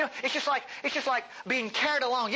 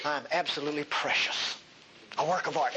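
tender God; 5 semitones lower than usual. Retold or imitated. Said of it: "has the unmitigated nerve to say".

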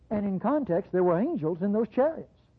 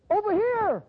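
much higher, playful call from the hill; mock call out; 13 semitones higher than usual. Hiding man mockingly calls the army, much higher. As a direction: hail the enemy in jest. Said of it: "stepping out from behind the hill and saying".

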